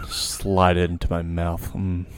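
hammed-up close-mic ASMR voice; joking ASMR about pizza; mock ASMR. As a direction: savour every word, mock soothing. Mock ASMR bit, slow.

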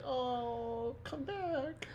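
put-on high, soft pleading cry of a small child; childlike wail. Grogu crying out as the airship leaves. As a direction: plead like a little kid left behind. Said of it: "and he's like".

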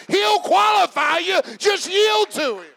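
loud, high, shouted sermon climax; preaching at full force. Acted. Preacher exhorting the congregation, shouting with fervor. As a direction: preach it hard, rising to a shout.

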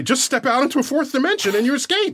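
high-pitched, incredulous taunt; mock exasperation. Four-dimensional beings jeering at prisoners in a cell, mock exasperated. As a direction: scoff in a high voice at the helpless captives. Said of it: "They look at prisoners in a prison cell and say".

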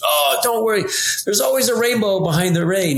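mock-soothing old winemaker brushing off worries; imitating Andre's cheery comfort. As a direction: play him up as the ever-sunny optimist. Mimicking Andre's cheery reassurance.

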